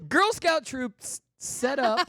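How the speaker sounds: slow, loud, high announcing; headline read. Acted. Announcing the next story.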